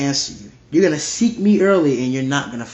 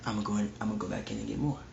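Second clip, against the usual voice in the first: quick, hushed mock rush of words; greedy robber's thought; 5 semitones lower, 12 dB quieter, much faster than usual. Robber rushing back for more, fast and hushed. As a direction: rattle it off under your breath like a greedy thief.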